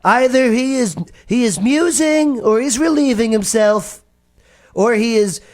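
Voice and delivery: very high, sustained taunting voice; mocking Elijah. Elijah ridiculing a god who won't answer, high mocking voice. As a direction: jeer in a put-on high pitch. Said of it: "mocked them, saying".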